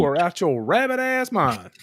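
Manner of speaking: high, loud scolding; incredulous scolding. Retold or imitated. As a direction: tell him he's crazy. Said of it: "retold heated reply to Randy".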